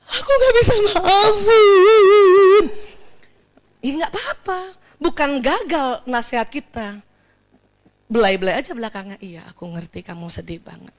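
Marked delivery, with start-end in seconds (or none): 0.1-2.6 loud, slow, wailing half-laughing refusal; tearful refusal. Pretend. Grieving mother refusing to forgive, wailing slowly. 9.2-10.9 very soft, low comforting murmur; soothing. Pretend. Companion soothing a grieving mother, very softly.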